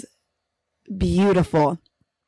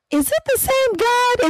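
pitched-up doubtful challenge; skeptical question. Retold or imitated. Speaker mimics doubting a bare scripture post, high and incredulous. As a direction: question it high in mock disbelief. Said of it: "and I'm like".

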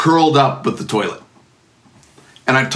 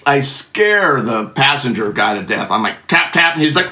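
ordinary delivery

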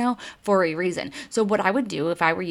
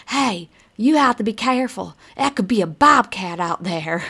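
louder, drawling imitation of an Alabama brother; Alabama accent; 3 semitones higher, 5 dB louder than usual. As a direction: warn him in a put-on Alabama accent. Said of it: "his brother is from Alabama".

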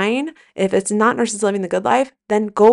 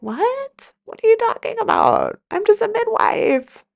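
high, incredulous squeak of a new midwife; stunned disbelief. Retold or imitated. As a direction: play your younger self hearing something absurd. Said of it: "I'd have been like".

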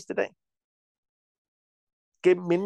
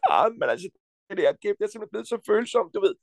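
whiny man mimicry; 6 semitones higher, faster than usual. Imitates a whiny sensitive man.

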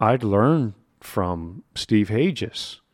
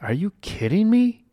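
incredulous; 11 semitones higher than usual. Retold or imitated. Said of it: "And I'm like".

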